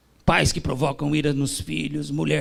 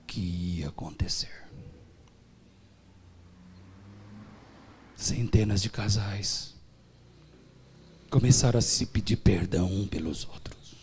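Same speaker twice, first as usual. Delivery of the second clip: hushed awe; 6 semitones lower than usual. Acted. Preacher telling the church the miracle, whispered.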